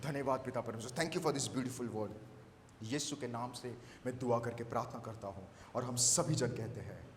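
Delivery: low, soft, reverent thanks; closing prayer. Real, not acted. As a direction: wind the prayer down quietly before the amen. (धीमी, नीची आवाज़ में प्रार्थना)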